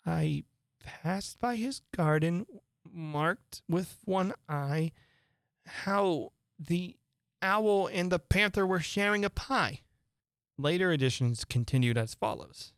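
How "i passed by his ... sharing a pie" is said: slow, higher, shaky recital; trembling. Acted. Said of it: "went on in a trembling voice".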